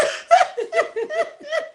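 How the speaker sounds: long high-pitched laughter. Real, not acted. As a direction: cackle with delight. Laughs aloud.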